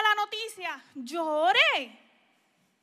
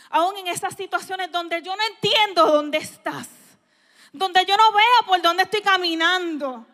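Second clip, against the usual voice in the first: building worship; 9 dB louder than usual. Retold or imitated.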